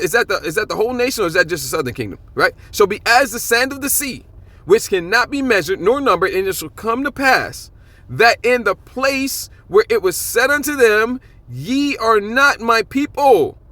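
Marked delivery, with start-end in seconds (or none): 2.7-13.6 slow, higher, solemn reading of the verse; scripture reading. Acted. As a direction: dwell on each phrase as scripture. Reads scripture aloud.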